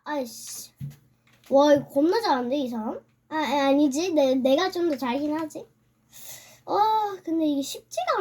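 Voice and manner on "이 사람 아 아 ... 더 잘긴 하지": usual speaking voice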